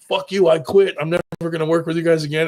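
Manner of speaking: loud, high angry outburst; angry quit. Retold or imitated. Alan quitting on the crew, shouting in fury. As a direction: yell it and storm off.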